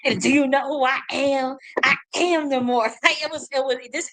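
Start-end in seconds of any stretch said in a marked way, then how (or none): 0.2-2.8 hammed-up imperious boast; mock haughty Moor. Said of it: "started talking like that".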